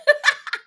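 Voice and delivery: high, loud laugh; squealing laugh; loud burst of laughter. Real, not acted. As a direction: let out a big high laugh.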